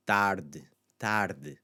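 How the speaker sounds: "tarde" ending almost on a bare d; swallowed final e. Shown as a demonstration. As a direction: cut the final e of "tarde" down to a trace. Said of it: "see what it does to the D".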